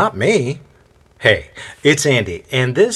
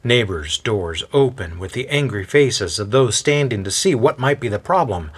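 usual speaking voice

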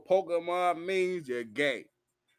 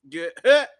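sharp, much louder get hit; loud snap; 12 dB louder than usual. Real, not acted. Warning people who play games, loud sharp bark. Telling off game players.

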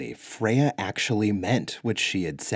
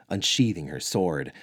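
ordinary delivery, nothing marked

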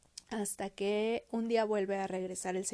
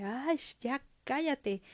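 slow, higher mock exasperation; exasperated Rottenmeier; 4 semitones higher than usual. Rottenmeier silences Heidi, slow and exasperated. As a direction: shut a child up with drawn-out impatience. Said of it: "la señorita Rottenmeier le dice".

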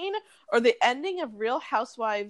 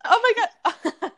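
mock shocked shriek; 12 semitones higher, 7 dB louder, much faster than usual. Reenacting reality show dinner fight.

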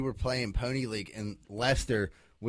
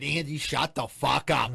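slow, emphatic imitation of an angry mother; aggressive mom; 2 semitones higher, 4 dB louder, slower than usual. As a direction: lean hard on each word like a mad mom. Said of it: "like, aggressively".

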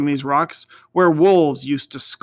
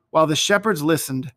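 ordinary delivery

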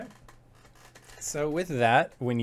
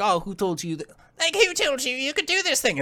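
imitating Creeping Lynn; 11 semitones higher, faster than usual. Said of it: "Creeping Lynn's just like".

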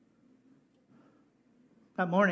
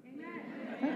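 laughing amen; 10 semitones higher, 6 dB quieter than usual. Real, not acted. Answering own call for amen.

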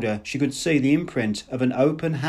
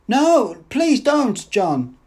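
high, slow, loud begging; drawn-out, high, fearful appeal; frightened plea; 11 semitones higher, 5 dB louder, much slower than usual. A narrator's reading. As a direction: beg not to be hit. Woman cowering from his fists.

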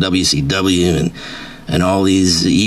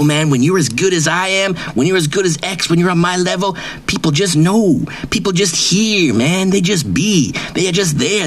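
pitched-up, swaggering braggart voice; cocky wrestler persona; 9 semitones higher than usual. Acted. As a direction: brag like a washed-up wrestling star who thinks he's famous. Persona reads fake email, brags.

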